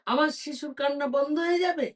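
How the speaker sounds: high, loud, quick eager question; hopeful surprise. Acted. Queen asks the beggar if her baby will stop crying, eager surprised question. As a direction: grab at the hope of a cure.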